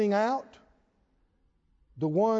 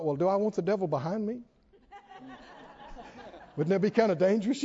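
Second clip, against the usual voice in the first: 1 amused; 3 dB quieter, faster than usual. Real, not acted. Joking inner thought with laugh.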